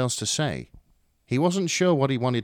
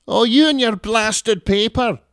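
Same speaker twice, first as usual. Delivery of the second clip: much higher and louder, yapping terrier voice; grating Scottish mum; 13 semitones higher, 12 dB louder, slower than usual. Acted. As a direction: yap it like a cranky little terrier. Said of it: "Her voice was grating".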